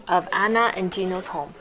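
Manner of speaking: plain reading voice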